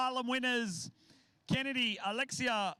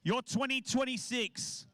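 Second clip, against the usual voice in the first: announcer. Acted. Announces award winners.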